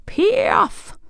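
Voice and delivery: very high witchy squeal of a magic word; cried incantation. A narrator's reading. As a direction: cast the spell in a high cry. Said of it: "and cried".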